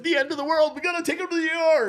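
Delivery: high, fast hammed-up alarm; dad mocking his own overreaction, high and fast; mock panic. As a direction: squeal it like a panicking parent. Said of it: "I'm like".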